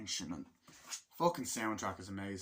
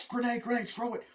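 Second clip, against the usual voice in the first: much higher, hammed-up soldier yelling orders; battle yell; 12 semitones higher, 4 dB louder than usual. Soldier yelling at a comrade in the firefight, mock shout. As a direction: yell at the squad to throw the grenade.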